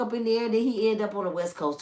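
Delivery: ordinary delivery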